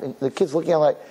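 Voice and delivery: ordinary talk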